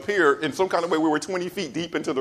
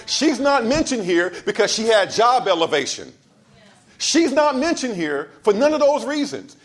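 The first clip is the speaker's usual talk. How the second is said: raised, higher-pitched sermon delivery; impassioned preaching; 9 semitones higher, 4 dB louder than usual. Acted. Preacher tells the congregation why Anna is in the Bible, impassioned preaching. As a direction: drive each phrase home from the pulpit.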